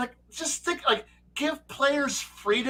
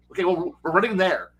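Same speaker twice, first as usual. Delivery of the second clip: ordinary talk, nothing marked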